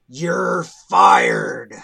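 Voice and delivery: low, loud gravelly line. Acted. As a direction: growl the firing like a gruff hero. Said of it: "Rick said in his gravelly voice".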